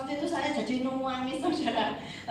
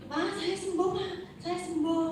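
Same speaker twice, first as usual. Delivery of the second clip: higher-pitched retold exclamation; excited announcement; 7 semitones higher than usual. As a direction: replay the happy news eagerly, pitch raised. Retells telling Pak Edi of healing. (menirukan seruan gembira, nada naik)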